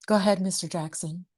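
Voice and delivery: lightly voiced Aunt Bev egging him on; Aunt Bev impression. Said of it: "I may not be Aunt Bev".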